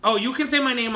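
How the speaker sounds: ordinary talk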